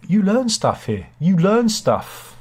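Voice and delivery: playful show-off; mock proud boast. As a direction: crow it like a smug schoolteacher.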